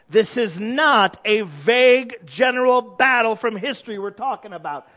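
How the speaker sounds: loud, high, slow building sermon; emphatic slow pulpit preaching; full-force preaching. Acted. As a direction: preach it hard and drawn out.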